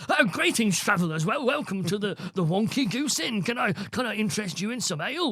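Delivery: high, lilting innkeeper greeting; hammed-up fantasy innkeeper; role-play innkeeper. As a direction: welcome travellers in a put-on, theatrical tavern voice. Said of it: "totally change who you are".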